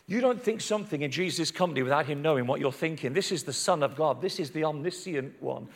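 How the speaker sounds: ordinary delivery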